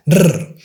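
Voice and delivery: "Rrr" as a long strong trill; rolled R. Shown as a demonstration. Teacher showing the double R trill. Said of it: "pronounce the strong R".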